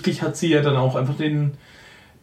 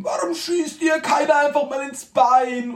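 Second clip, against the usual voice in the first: mock outraged shout; 8 semitones higher, slower than usual. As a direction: belt it out like a fake rant. Reading own notes in mock outrage.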